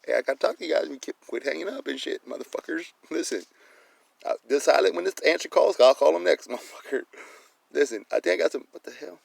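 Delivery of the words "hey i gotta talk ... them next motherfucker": higher, louder, rushed mock dispatcher voice. Mimicking the police dispatcher scolding a repeat caller. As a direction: keep ranting as the fed-up dispatcher. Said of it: "You're like".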